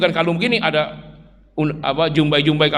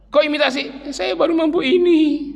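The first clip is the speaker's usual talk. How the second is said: lightly voiced quarrel between wife and husband, higher; acted wife and husband; 12 semitones higher than usual. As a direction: play the surprised wife, then the sheepish husband.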